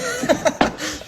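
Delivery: real high, breathy laugh; amused laugh. Real, not acted. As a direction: burst out laughing at the slip. Amused by the English word clinch.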